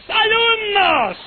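very high, loud, long-drawn call; old-time schoolteacher. Retold or imitated. Teacher standing before her class, mimicked schoolmarm call. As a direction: summon the class like a hammed-up schoolmistress. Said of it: "se paraban y decían".

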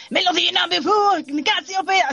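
high, slow, over-the-top announcer; exaggerated announcer voice. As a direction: ham it up like a TV voiceover. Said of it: "Como súper exagerado".

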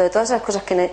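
ordinary talk

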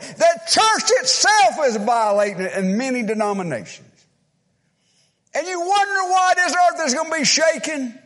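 high, loud pulpit preaching; fiery preaching. Real, not acted. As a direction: thunder the sermon at the congregation. Preaching at full force.

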